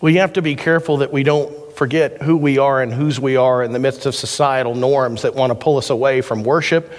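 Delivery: ordinary talk, nothing marked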